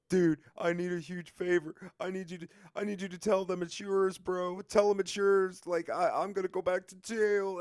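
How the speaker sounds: high, loud, urgent begging; retold frantic plea. Friend begging to avoid going back to jail, frantic plea.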